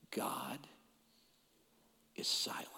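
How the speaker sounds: hushed, breathy whisper; a whisper of God's silence. Real, not acted. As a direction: whisper it so the room leans in. Dramatic point about silence.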